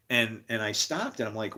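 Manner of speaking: ordinary talk, nothing marked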